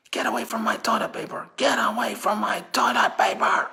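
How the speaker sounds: high, loud mock shriek; screaming shopper. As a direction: shriek to guard the toilet paper. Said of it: "they're screaming and shouting".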